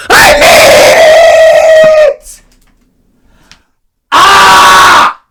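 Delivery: high, loud, stretched-out wail; desperate scream. Real, not acted. Broke student pleading to camera, drawn-out desperate scream. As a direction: drag the cry out in desperation. Desperate about needing money.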